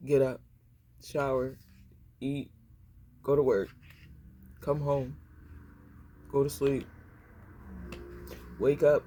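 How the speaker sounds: low, flat-paced list with long pauses; robotic routine. Retold or imitated. Mimicking Larry's endless daily routine, low robotic drone. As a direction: recite the chores like a worn-out machine. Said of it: "You a robot doing the same damn thing".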